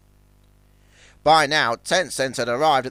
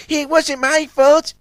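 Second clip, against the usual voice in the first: high, louder defensive snap; snapping; 12 semitones higher, 5 dB louder, slower than usual. A narrator's reading. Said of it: "snapped Zuck".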